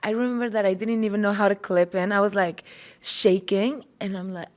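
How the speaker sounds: ordinary talk, nothing marked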